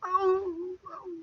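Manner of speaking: high hound-dog howl, loud then fading; dog's whine. Retold or imitated. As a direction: imitate an old hound's whine. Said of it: "he would whine".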